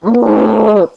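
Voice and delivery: long, high exasperated groan; frustrated groan. Real, not acted. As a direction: groan loudly in protest. Frustrated at spell being dispelled.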